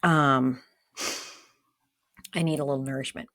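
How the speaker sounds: talking while chewing popcorn; munching popcorn. Real, not acted. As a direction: mouth full of popcorn. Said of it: "I'm eating popcorn right now".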